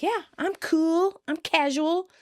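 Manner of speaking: forced casual. Retold or imitated. Chandler assuring Monica he's okay, forced casual. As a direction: stretch it out, pretending to be cool. Acted unconvincing claim to be relaxed.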